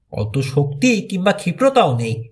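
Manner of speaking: usual speaking voice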